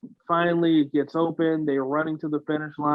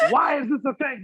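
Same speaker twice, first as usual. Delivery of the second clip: higher whiny wail; 10 semitones higher than usual. Retold or imitated. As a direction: whimper it out, near tears in mock despair. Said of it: "I'm just like".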